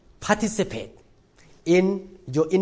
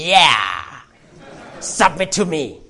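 smug husbands; 4 dB louder, slower than usual. Retold or imitated. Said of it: "And all the men say".

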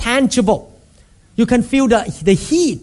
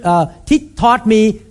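ordinary talk, nothing marked

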